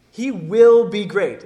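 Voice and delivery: slow, forceful declaration leaning heavily on WILL; emphatic. Shown as a demonstration. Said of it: "It's emphatic".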